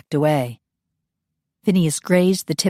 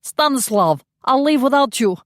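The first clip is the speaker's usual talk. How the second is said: urgent call; 8 semitones higher than usual. A narrator's reading. Yori urging comrade.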